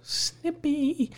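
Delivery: high, soft, drawn-out echo; teasing little singsong repeat; mock echo. Friend echoing husband's word snippy, mock echo.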